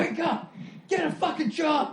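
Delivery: high-pitched mock yell at the homeless; hammed-up angry street yell; angry yelling kid. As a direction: imitate a furious Boston kid barking at strangers. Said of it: "that angry Boston kid yelling".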